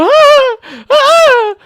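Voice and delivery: high, loud shrieks; imitated scream. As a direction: scream as if you just saw a mouse. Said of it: "she just starts screaming".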